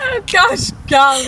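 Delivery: mock kid swearing. Teasing imitation of kid's mild swearing.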